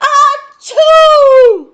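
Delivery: enormous booming achoo; huge loud sneeze. Pretend. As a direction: sneeze as loud as you can. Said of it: "how he would sneeze?".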